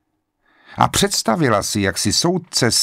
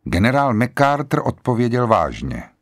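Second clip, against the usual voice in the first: ordinary delivery, nothing marked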